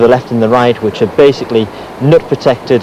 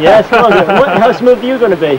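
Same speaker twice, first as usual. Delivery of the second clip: excited teasing call; 9 semitones higher than usual. Real, not acted. Teasing the climber about to start.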